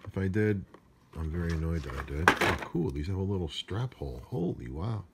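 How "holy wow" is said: normal talking voice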